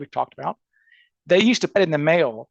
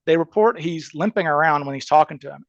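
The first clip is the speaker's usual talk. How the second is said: ordinary talk, nothing marked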